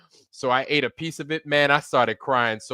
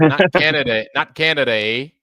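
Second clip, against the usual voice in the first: louder, mock Canadian accent ending in "eh?"; 3 semitones higher, 7 dB louder than usual. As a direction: tease like a hammed-up Canadian. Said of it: "we're not talking about Canada".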